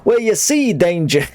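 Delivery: high-pitched New York character voice; New York accent. Acted. New Yorker starting to explain to Danger, put-on New York accent. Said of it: "Fine New York accent".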